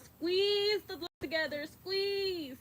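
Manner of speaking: high, drawn-out coaching cue; urging squeeze. Real, not acted. Coach urging the class through the lift, high and urging.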